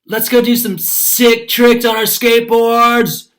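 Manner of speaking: loud, high, drawn-out yell; big excited holler. A narrator's reading. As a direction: yell it out slowly to the whole crowd. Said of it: "yelled Vince".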